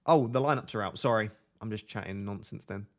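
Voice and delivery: ordinary talk, nothing marked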